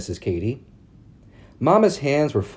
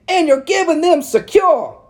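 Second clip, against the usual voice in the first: high, loud prissy society matron; prim town lady; 6 dB louder than usual. A narrator's reading. Narrator voicing Missus Cady calling on Mama, high and prim. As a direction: lift it up into a fussy church lady. Ladies voiced in read story.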